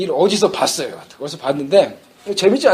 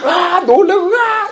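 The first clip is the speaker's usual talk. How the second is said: mock fright; 8 semitones higher, much slower than usual. Cat startled by mice.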